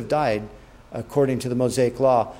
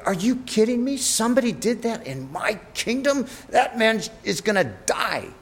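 higher-pitched incredulous outburst, retold; raised, indignant king hearing of the stolen lamb; outraged disbelief; 8 semitones higher than usual. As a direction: play David's mock fury at the injustice. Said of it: "David is enraged".

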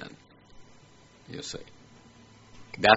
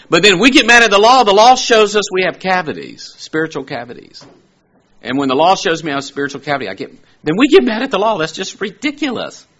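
higher and louder, fast and driving; fiery preaching; 11 semitones higher, 9 dB louder, faster than usual. Acted. Preacher rebuking his congregation with fiery, driving force. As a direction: preach it hard, building the point.

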